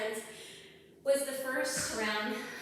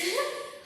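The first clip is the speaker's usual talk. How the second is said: short laugh; 13 semitones higher than usual. Real, not acted. Laughs at octopus image.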